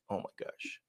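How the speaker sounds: embarrassed whisper under breath. Real, not acted. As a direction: whisper it to yourself. Embarrassed aside to himself.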